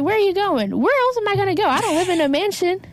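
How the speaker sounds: a hint of indignant retort. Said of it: "Tammy's like".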